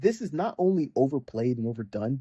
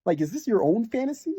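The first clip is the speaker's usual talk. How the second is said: incredulous rant; 9 semitones higher than usual. Retold or imitated. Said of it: "where you're like".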